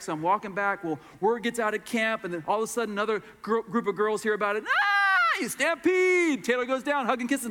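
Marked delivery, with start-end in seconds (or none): 4.6-6.4 high, loud squeal, stretched slowly; excited squeal. Retold or imitated. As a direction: shriek and drag out the stampede. Second girls group squealing.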